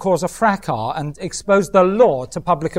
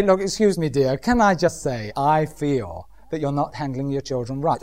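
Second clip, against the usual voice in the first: softer, lower tactful advice; gentle older woman; 5 semitones lower, 5 dB quieter than usual. Retold or imitated. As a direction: ease into correcting a young mother kindly. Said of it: "they have to come up and say".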